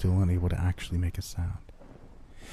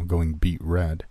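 plain reading voice

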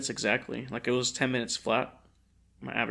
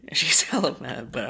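breathy giggling aside; laughing whisper; 3 dB louder than usual. Real, not acted. Laughing aside about angry mom.

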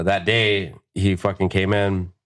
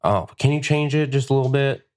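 higher-pitched picky customer; mock customer request, pitched up; lightly voiced Karen; 6 semitones higher than usual. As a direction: put on a hint of a fussy client, voice lifted. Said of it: "fucking a Karen coming in being like".

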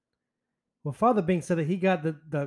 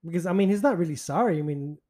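ordinary talk

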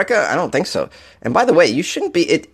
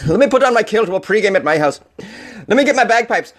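mock Scottish accent; 7 semitones higher than usual. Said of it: "That's my impression".

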